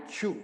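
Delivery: च्यू said as a clear chew; teacher gives the right form; correct chu. Shown as a demonstration. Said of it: "क्या बोलना है?".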